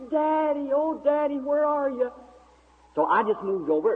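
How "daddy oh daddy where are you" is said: put-on high, sustained call of a lost girl; imitated long, high holler. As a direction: sing it out high, searching for a parent. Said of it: "going on hollering".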